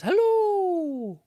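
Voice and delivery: high, loud, long-held singsong hello; friendly calling out. A narrator's reading. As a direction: call brightly to someone far away. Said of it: "someone says".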